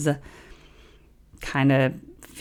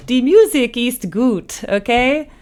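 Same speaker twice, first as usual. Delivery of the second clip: put-on German accent; 7 semitones higher, 5 dB louder than usual. Playful German phrase.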